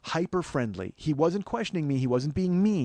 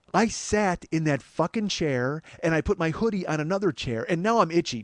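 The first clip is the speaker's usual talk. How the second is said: usual speaking voice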